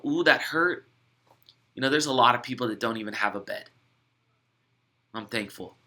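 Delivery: grateful calm. Pretend. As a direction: let the hurt go and land gently on thankful. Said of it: "you just flip the gratitude switch".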